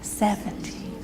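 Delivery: seventeen breathed in a whisper. Shown as a demonstration. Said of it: "whisper every other number".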